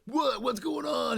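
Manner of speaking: high, loud panicked call, imitated; alarmed husband. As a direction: act out a startled man calling downstairs. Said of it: "And he's like".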